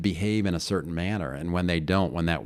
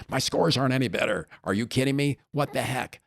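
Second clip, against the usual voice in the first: imitating angry client; 8 semitones higher than usual. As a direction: voice the upset client, pitched well up. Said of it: "she was angry".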